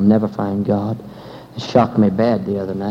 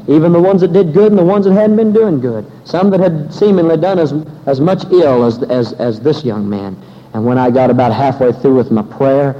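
loud, driving sermon delivery; fervent preaching; 6 dB louder than usual. Acted. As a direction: preach it at full force to the congregation. Preacher recounts prayer, full force.

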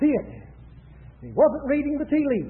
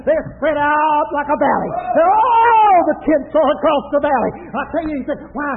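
raised, shouted sermon delivery; full-force preaching; 3 semitones higher, 6 dB louder than usual. Acted. Preacher pictures Israel's tents for the congregation, full-force preaching. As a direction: preach it out with long, cried-out openings.

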